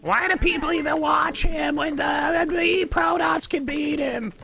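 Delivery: high, slow mock rant of an outraged viewer. Mocking a chat viewer, high hammed-up outraged rant. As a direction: ham up an indignant fan yelling.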